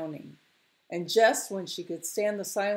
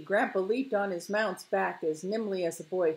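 plain reading voice

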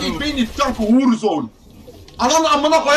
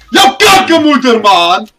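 furious yell; 9 dB louder, slower than usual. Real, not acted. Raging at chat.

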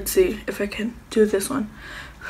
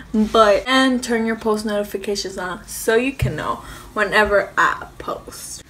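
usual speaking voice

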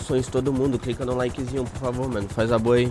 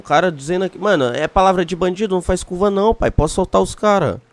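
higher, louder, pushing hard; insistent; 7 semitones higher, 5 dB louder than usual. Acted. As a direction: press the point forcefully. Pressing hostage negotiator.

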